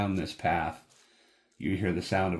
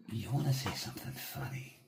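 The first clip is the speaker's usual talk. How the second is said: menacing figure; 8 dB quieter than usual. Acted. Figure in the player's face, softly threatening whisper. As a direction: get right in their face, quietly dangerous. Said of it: "he gets right into your face. And he says".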